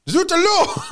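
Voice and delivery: high, hammed-up French exclamation; mock French accent. Norman knight announcing himself in a story, mock French accent. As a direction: burst out as a cartoon Frenchman. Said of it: "that general kind of accent".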